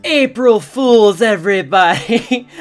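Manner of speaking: loud, slow, triumphant shout; spring the prank on the audience; gleeful reveal. Real, not acted. Joke reveal to viewers.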